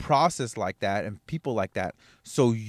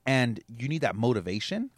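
ordinary talk

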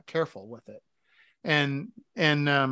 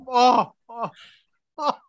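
ordinary talk, nothing marked

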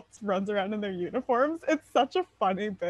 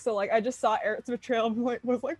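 usual speaking voice

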